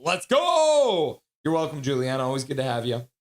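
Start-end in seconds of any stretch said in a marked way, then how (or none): 0.0-1.2 excited cheer. Real, not acted. Cheering his team on.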